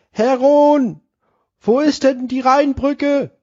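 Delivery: calling passer-by. Acted. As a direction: call after him from afar. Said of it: "ruft ihm nach". (rufender Passant, viel höher)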